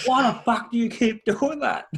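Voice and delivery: high-pitched, hammed-up indignant complaint; squeaky mock outrage for laughs; mock exasperation. Mimics the quoted complaint back.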